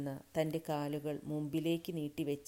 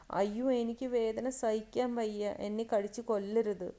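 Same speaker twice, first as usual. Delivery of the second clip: high, raised, acted wail of pain; sobbing cry; 6 semitones higher, 6 dB louder than usual. A narrator's reading. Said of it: "തേങ്ങിക്കൊണ്ട് വിളിച്ചു പറഞ്ഞു". (തേങ്ങിക്കൊണ്ടുള്ള വിലാപം)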